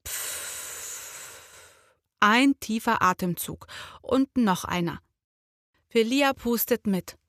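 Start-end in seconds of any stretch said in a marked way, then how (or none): 0.1-2.0 unvoiced slow exhale through lips; soft steady puff of breath; blowing out. Shown as a demonstration. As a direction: blow the air out like calming a volcano. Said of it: "Er macht es vor".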